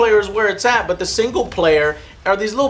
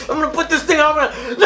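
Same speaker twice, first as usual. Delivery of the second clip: comic rant; 9 semitones higher, much faster than usual. Real, not acted. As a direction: shout it, then crack up. Mock rage about microtransactions.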